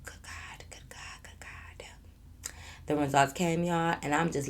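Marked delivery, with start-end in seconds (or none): 0.0-1.5 breathless whispered disbelief; dread-filled hushed whisper; stunned whisper. Real, not acted. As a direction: whisper it, barely able to look. Stunned by the test results.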